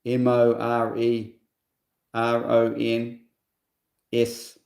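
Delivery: slow, level, letter-by-letter delivery; spelling it out. Real, not acted. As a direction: spell it slowly, one letter at a time.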